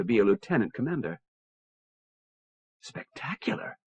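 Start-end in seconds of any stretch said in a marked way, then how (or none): 2.8-3.8 breathy awed whisper. Acted. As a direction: whisper it in quiet wonder. Admiring view of hologram.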